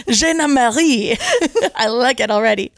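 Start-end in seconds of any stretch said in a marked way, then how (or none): 0.1-1.2 slow, playful French name; mock French accent, drawn out. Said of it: "get him to call me".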